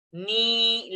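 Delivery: "Ni" with the vowel drawn out; long held ni. Shown as a demonstration. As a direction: hold "Ni" long.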